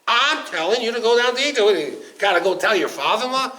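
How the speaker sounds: God scolds Moshe for wanting to ask his father-in-law, exasperated; indignant God. Acted. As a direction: scold him for the delay.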